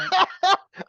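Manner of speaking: high, loud burst of laughter. Real, not acted. Host reacting to a surprise answer, loud laugh. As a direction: crack up loudly, caught off guard.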